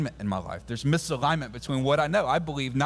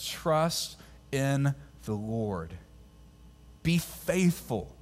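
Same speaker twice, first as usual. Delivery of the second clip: slow, hushed, reverent quote; slow scripture reading; much slower than usual. Shown as a demonstration. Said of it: "He says".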